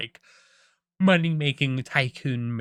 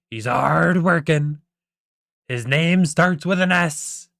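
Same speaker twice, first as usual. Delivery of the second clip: loud, slow, raised announcer voice; Mister Krabs; 4 semitones higher, 6 dB louder, slower than usual. Acted. Crab boss hinting at the new manager, slow loud proclamation. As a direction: draw out each clue for the crowd. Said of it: "Mister Krabs eventually announces".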